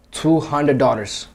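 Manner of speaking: usual speaking voice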